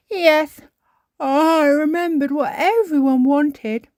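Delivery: high, loud words stretched through a yawn. A narrator's reading. Peppa is home in the evening, yawning as she talks. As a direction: talk through a big storybook yawn. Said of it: "Peppa yawned".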